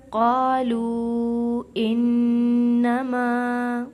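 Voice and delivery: slow, loud chant with the long vowels held. Acted. Teacher recites the verse to students in chant. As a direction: sing it out like a reciter.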